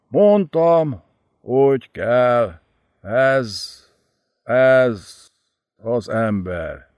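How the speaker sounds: slow, broken, halting words of a dying man; each phrase costs him breath; dying with effort. Acted. Dying Szumurri confesses to his lord, slow and broken. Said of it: "iszonyú erőfeszítéssel beszélni kezdett".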